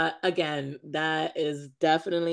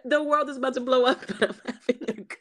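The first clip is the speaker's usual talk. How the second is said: laughing mock gush; 7 semitones higher, faster than usual. Mocking the couple, laughing.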